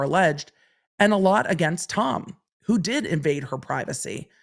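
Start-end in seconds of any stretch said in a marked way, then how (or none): none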